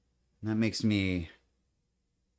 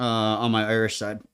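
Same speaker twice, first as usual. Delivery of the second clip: usual speaking voice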